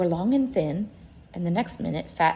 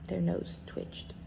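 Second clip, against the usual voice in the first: usual speaking voice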